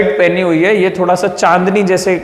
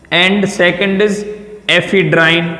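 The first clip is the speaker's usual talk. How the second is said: slow dictation; much slower than usual. Shown as a demonstration. Teacher dictating drug names.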